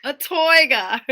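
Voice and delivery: high, loud hammed-up "toiga" for tiger; mock Goldmember accent. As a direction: play it like a silly movie accent. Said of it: "What accent is that?".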